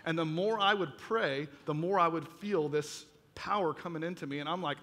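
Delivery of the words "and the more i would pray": usual speaking voice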